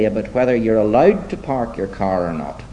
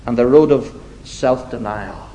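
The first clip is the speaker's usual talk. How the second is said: measured, emphatic sermon delivery with deliberate pauses; preaching; 6 semitones higher than usual. Acted. Preacher setting two roads before his congregation, preaching. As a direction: preach it slowly, leaning on the key words.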